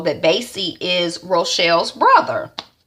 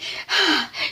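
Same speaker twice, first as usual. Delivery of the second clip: breathy sigh; 9 semitones higher, 4 dB quieter than usual. Retold or imitated. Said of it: "she's all breathing hard".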